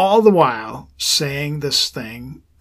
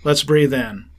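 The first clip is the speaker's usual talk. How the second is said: guided breath prayer; 3 dB quieter than usual. Acted. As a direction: lead the prayer softly, breath by breath.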